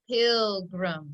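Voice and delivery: PILGRIM drawn out in two even syllables; slow blending. Shown as a demonstration. Teacher putting it together for pupils, slowly blending PILGRIM. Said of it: "Let's put it together".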